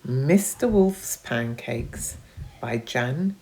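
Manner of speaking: plain reading voice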